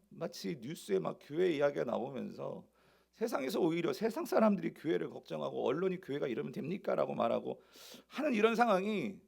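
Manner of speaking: usual speaking voice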